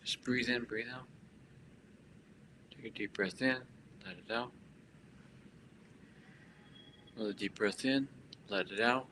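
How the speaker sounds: soft, unhurried meditation guide; hushed breathing instructions; guided breathing. Real, not acted. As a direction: lead the breath quietly, with long pauses.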